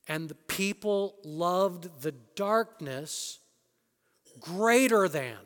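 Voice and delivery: And the people loved the darkness drawn out slowly; preacher reads John to the congregation, slow and weighty; slow scripture read. Acted. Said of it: "This is John".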